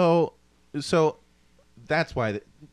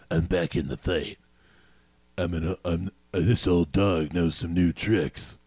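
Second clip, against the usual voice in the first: game hero voice; 4 semitones lower, slower than usual. Acted. Quoting a game character's respawn line.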